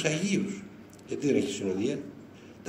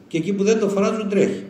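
excited exclaim; 6 semitones higher, 7 dB louder than usual. Real, not acted. Exclaims about the saint's miracles.

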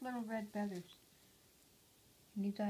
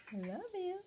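pet talk; 10 semitones higher than usual. Real, not acted. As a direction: croon it up high to the pet. Saying love to the bird.

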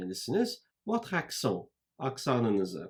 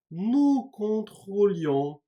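slow, higher, louder "Nous contrôlions"; slow syllabic model; 8 semitones higher, 5 dB louder, slower than usual. Shown as a demonstration. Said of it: "nou'ya göre çekimleyeceğiz".

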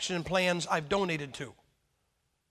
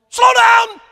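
much higher, blaring yell through a bullhorn; bullhorn shout; 14 semitones higher, 17 dB louder than usual. Pretend. Preacher wishing God warned drivers by bullhorn, blaring shout. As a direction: blast it like a megaphone from heaven. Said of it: "I kinda would like a bullhorn".

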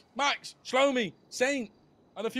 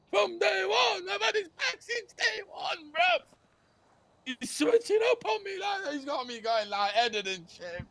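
high, shouted betrayal rant; angry rant; 9 semitones higher, slower than usual. Acted. Gang boss raging that his crew betrayed him, shouting. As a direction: rant at the traitor.

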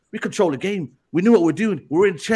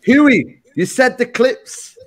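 ordinary talk, nothing marked

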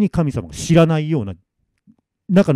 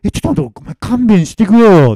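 panicked pleading; 8 semitones higher, 7 dB louder than usual. Acted. Masamitsu begging the ghost to spare him, panicked. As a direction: beg with a stretched wail. Man pleads not to die.

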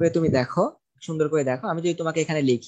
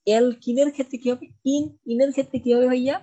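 mock student question; 6 semitones higher, 4 dB louder than usual. Teacher voices student question.

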